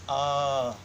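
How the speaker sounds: "ah" held open with a free breath; open vowel sound. Shown as a demonstration. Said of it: "when you make this sound". (vowel demo for the class)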